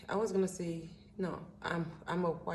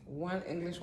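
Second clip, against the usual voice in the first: ordinary talk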